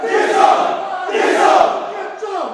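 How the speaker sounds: raw full-voice chant; yelling. Real, not acted. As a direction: roar it with the crowd. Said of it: "to the highest of your voice can take".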